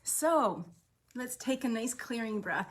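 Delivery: usual speaking voice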